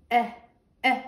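"Eh" as a short open e; short e sound. Shown as a demonstration. Teacher sounding "Eh" as a short e for kids. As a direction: model the short e for the class. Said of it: "That's her focus letter".

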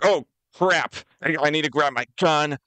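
high, startled scramble to action; mock panic. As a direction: jump into a hammed-up panic. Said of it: "everyone's like".

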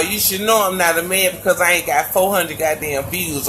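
normal talking voice

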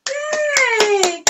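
high happy cheer. Real, not acted. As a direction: celebrate with a high yay. Cheering after rhyme.

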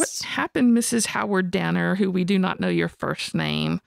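high startled reading of the news; mock shock. Host pretending to read the roast theft story, shocked. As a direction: act floored by the headline. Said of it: "opening up the newspaper and be like".

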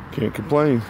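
usual speaking voice

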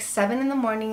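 ordinary talk, nothing marked

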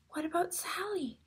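hushed suspense whisper. Real, not acted. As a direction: lean in and whisper. Building suspense for children.

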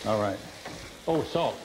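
normal talking voice

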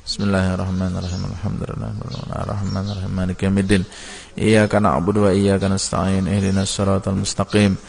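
Quran recitation. Real, not acted. Teacher closes the lesson reciting al-Fatihah, low and calm.

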